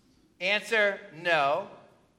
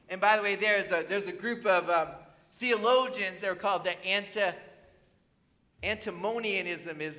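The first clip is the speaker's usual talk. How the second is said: ordinary talk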